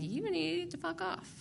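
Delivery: slow, high, drawn-out dismissal; telling her off. Retold or imitated. Retelling how she told the sponsor off, slow and high.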